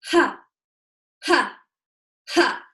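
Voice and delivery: single punched "ha", spaced out one by one; staccato "ha" exhales. Shown as a demonstration. Teacher breaking the move down slowly, separate forceful "ha" bursts. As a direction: land each "ha" separately with a sharp push. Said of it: "ha happens when the pelvis is going forward".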